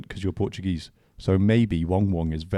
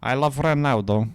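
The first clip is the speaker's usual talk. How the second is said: slow, higher-pitched Portuguese accent; louder, drawn-out imitation of a Portuguese accent; 5 semitones higher, 4 dB louder than usual. Shown as a demonstration. Mimicking the co-host's Portuguese accent on request, slow put-on Portuguese accent. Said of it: "can I hear your Portuguese accent".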